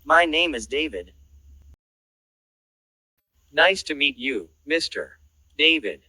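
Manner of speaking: ordinary talk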